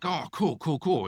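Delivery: high, bright, overeager cool cool cool; mock-excited brush-off; fake enthusiasm. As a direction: play along with exaggerated cheer. Mock-eager reply to fire chief.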